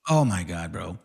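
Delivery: low exasperated groan of words; weary disbelief, pitched well down. Real, not acted. Exasperated at opponent dodging the question.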